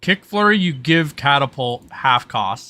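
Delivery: ordinary delivery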